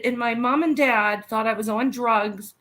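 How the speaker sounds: ordinary talk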